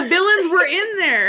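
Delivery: loud, high insistence through laughter; real amused outcry; laughing protest. Real, not acted. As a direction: insist over a friend's objection, laughing. Laughing protest over friend's objection.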